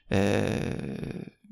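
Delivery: normal talking voice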